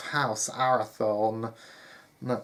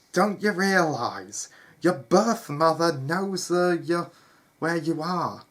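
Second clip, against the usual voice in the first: loud, higher, worried outburst; urgent warning; 6 semitones higher, 7 dB louder than usual. Acted. Gabriel warning his half-brother he is hunted, urgent warning. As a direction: press the danger on him. Brother warns Sam of danger.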